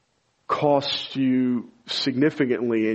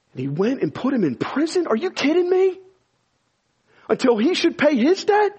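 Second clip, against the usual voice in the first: much higher, incredulous preacher's cry; outraged disbelief; 14 semitones higher, 3 dB louder than usual. Acted. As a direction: hurl the questions in outrage. Outraged rhetorical questions on the parable.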